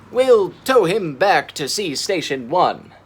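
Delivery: loud firm announcement; declaring. A narrator's reading. Tegs deciding to tow Mira home, firm declaration. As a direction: state it with resolve. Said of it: "Tegs declared".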